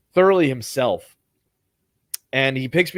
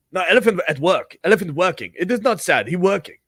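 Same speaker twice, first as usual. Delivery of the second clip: higher, louder broken English, insistent; Thai driver voice; 5 semitones higher, 4 dB louder than usual. Retold or imitated. Driver insisting the elephant is working, firm and higher. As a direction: set her straight like the stubborn driver.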